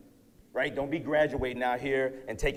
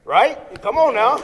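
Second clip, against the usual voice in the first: loud, high rallying call to the crowd; hype call; 7 semitones higher, 11 dB louder, slower than usual. Real, not acted. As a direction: fire up the room. Hyping up the student audience.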